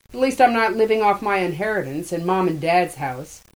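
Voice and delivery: angry. A narrator's reading. Hammy hitting back.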